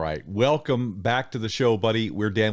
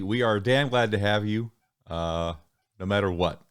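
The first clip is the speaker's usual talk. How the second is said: ordinary talk, nothing marked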